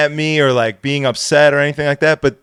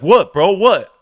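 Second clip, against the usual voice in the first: imitating a combative kid, higher and louder; confrontational; 6 semitones higher, 4 dB louder, slower than usual. Kid answering back to the angry DJ, confrontational. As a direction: square up with a raised voice. Kid squares up in retold quarrel.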